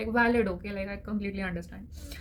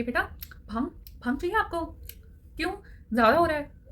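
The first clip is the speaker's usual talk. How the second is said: higher, faster, sharp sarcastic jab; stern sarcastic jab; 6 semitones higher, much faster than usual. Retold or imitated. Mom jabbing daughter about the bhang, still angry. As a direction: scold through the joke, still mad. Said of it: "मेरी mummy तो उसी वे में करती हैं".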